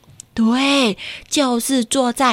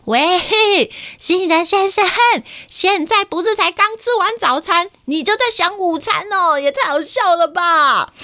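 teasing call; 8 semitones higher than usual. Acted. Calling to Mr Almond.

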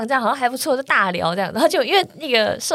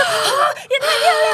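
much higher, laughing exclamation; amazed shout; 13 semitones higher than usual. Retold or imitated. To the vendor mistaken for a YouTuber, laughing amazed shout.

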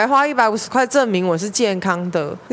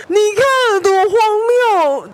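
higher and louder, laughing through it; laugh-crying; 12 semitones higher, 5 dB louder than usual. Real, not acted. Teller to audience, laughing outrage, higher and louder. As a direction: cry out the absurdity while laughing.

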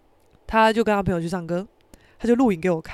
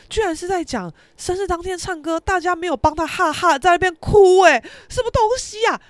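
high, rising to loud incredulity; imitated mocking disbelief; 8 semitones higher, 5 dB louder than usual. Friend posting about the crying, loudly incredulous. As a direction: scoff louder and louder.

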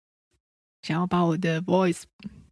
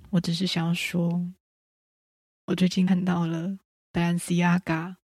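mysterious tone. Acted. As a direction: draw it out like a secret. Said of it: "为什么要用这种 神秘的语气".

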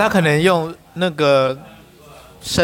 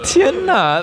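shocked gasp; 13 semitones higher, 3 dB louder than usual. Real, not acted. Reacting to a shocking story.

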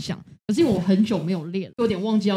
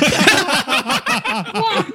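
high burst of disbelieving laughter; incredulous laugh; 12 semitones higher, 8 dB louder than usual. Real, not acted. Girlfriend recalling her shock, bursting into high laughter. Reacting to sleep-talk story.

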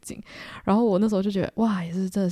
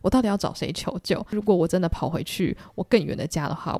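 ordinary talk

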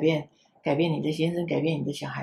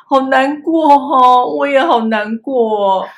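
slow, loud, wailing mock sob of a toddler; grandma imitating her crying grandson's whine; mock whimper; 7 semitones higher, 9 dB louder, slower than usual. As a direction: play the pouting grandkid, dragging it out.

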